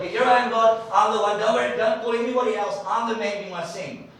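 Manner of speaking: high, loud keen offer; hammed-up eager Isaiah; eager volunteer. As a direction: push yourself forward eagerly. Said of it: "Isaiah was like".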